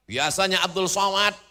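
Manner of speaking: high, loud building list; ringing oratorical build-up; grand preaching. Real, not acted. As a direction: proclaim each item bigger for the crowd.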